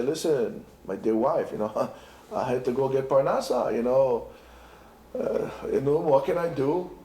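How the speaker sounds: low, mumbled husband's excuse with a chuckle; feeble excuse. Pretend. Husband excusing himself to his wife, low and sheepish. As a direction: make it sound weak. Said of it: "Are you going to say".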